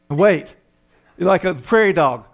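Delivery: usual speaking voice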